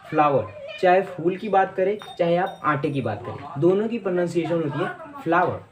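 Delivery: ordinary talk, nothing marked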